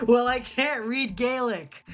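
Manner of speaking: high, drawn-out retort; exasperated protest. Real, not acted. Defends skipping the Gaelic test.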